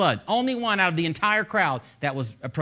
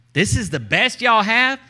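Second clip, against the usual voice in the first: loud, high, slow incredulous jeer; mocking giant, drawn out and loud; laughing taunt; 8 semitones higher, 9 dB louder, slower than usual. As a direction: laugh scornfully through the question. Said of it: "he's laughing".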